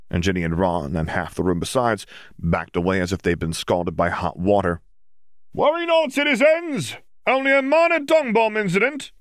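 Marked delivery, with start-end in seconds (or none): 5.5-9.1 high, loud hammed-up announcement; calling out. Prankster calming the room after a dung bomb, hammy loud call. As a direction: proclaim it to the whole room like a herald. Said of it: "someone called".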